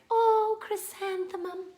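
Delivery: high, sighing admiration; longing. Acted. Classmates now gazing longingly at Chrysanthemum, dreamy longing. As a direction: swoon over the name in a high-pitched voice. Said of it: "looked at Chrysanthemum longingly".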